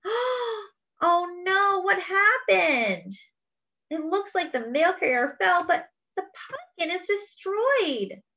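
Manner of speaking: high, tearful, slow lament; family wailing over the ruined pumpkin; crying dismay. Acted. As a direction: mourn the smashed pumpkin.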